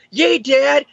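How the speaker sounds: put-on high child voice, cheering dad on; lightly voiced young son, bright cheer; kid voice. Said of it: "Robbie's son's like".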